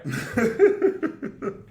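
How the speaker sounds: real high, loud laugh. Real, not acted. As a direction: burst out laughing at the clip. Reacts to the played clip.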